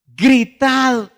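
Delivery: loud, high battle-order shout; commanding shout. Acted. Preacher voicing Joshua's order to the people, commanding shout. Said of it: "al pueblo diciendo".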